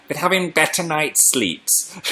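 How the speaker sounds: put-on soft, slow old lady voice. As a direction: croak it like a frail granny. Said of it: "I sounded like an old lady then".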